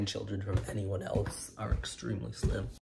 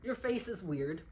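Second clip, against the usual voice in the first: put-on high, babyish voice for the infant's thoughts; high babyish voice, softer; baby's inner voice; 6 semitones higher, 4 dB quieter than usual. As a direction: narrate the baby's simple wants in a high, childish voice. Voicing a baby's simple logic.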